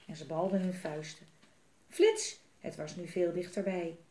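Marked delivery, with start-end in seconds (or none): 1.9-2.4 high, loud one-word burst; excited high exclamation; sudden flash. A narrator's reading. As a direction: pop it out high and startling.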